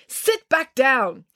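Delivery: high, drawn-out command; slow, irritated high-pitched order; angry order. A narrator's reading. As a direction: order them down crossly. Angry driver orders passenger to sit.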